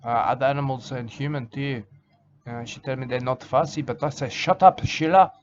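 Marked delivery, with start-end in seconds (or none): 4.3-5.3 sudden loud, higher snap at his wife; angry shout. Retold or imitated. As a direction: bark it at her, fed up. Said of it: "That's what I call her when I'm angry".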